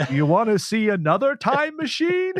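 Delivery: high, put-on voice with a teasing offer; voice of the tardis. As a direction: imitate a talking time machine luring a visitor. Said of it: "he pretends he's the voice of the tardis".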